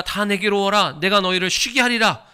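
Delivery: high, loud ringing proclamation; proclaimed words of the Lord. Retold or imitated. Pastor voicing the Lord's invitation to the congregation, loud and high. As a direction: thunder out the Lord's call to come. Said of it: "주님이 그러셨어".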